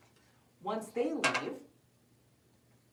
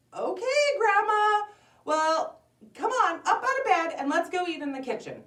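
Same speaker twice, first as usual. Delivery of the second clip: put-on high, loud, lively girl voice; coaxing little girl; 11 semitones higher, 8 dB louder than usual. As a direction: urge her along in a child's voice. Girl coaxing grandma out of bed.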